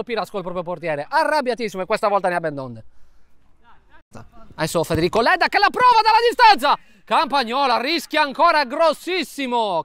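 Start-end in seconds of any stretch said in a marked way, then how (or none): 5.2-9.8 excited. Real, not acted. Dangerous long shot.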